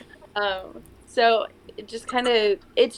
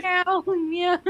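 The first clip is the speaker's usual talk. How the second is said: high-pitched imitation meow; silly high cat cry; playful cat meow; 9 semitones higher, much slower than usual. Joking cat noises amid the chanting.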